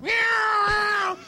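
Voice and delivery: high, loud crazed cat meow. Retold or imitated. Coach imitating the trapped cat, high and loud. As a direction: yowl like a panicking cat.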